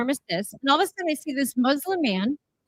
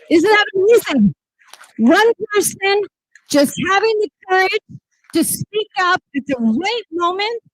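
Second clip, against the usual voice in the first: excited; 7 semitones higher, 6 dB louder than usual. Real, not acted. Rally speech, stirred up by story.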